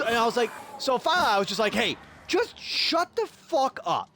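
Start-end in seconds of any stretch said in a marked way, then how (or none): 1.7-4.1 high, drawn-out exasperated retort; retold exasperation. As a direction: act out losing patience, slowly and pointedly. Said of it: "now I'm getting pissed".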